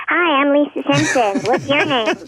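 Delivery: high, bright cartoon girl voice; Lisa Simpson voice. Shown as a demonstration. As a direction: break into the cartoon character voice. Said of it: "breaking into your cartoon character voice".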